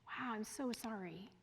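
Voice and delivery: put-on commiserate softly with a hurt child; tender it's-okay tone; gentle sympathy. Shown as a demonstration. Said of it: "that's a support role".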